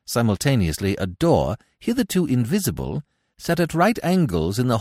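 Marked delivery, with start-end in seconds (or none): none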